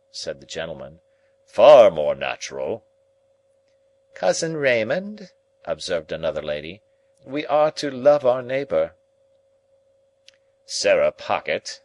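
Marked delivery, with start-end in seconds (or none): 1.4-2.8 dry gentleman. A narrator's reading. Gentleman character voice. 4.1-5.3 prim lady. A narrator's reading. Lady character voice. 7.2-8.9 high, loud, sanctimonious reminder; high, prim lady reproving a cousin; pious lady. A narrator's reading. 10.6-11.8 smug retort. A narrator's reading. Gentleman retort.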